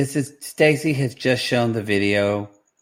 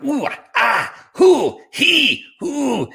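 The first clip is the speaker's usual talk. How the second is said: high, hammed-up moaning; imitating sex noises; mock sex noises; 11 semitones higher, much slower than usual. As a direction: ham up porno moans, pitched high. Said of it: "she's like".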